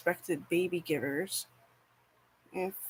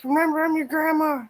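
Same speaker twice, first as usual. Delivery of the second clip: high, loud put-on grandma voice; be the grandma insisting who she is; 10 semitones higher, 9 dB louder than usual. Her great auntie insisting she's grandma, high and loud. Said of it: "She's like".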